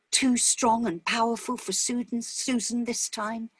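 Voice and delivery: plain reading voice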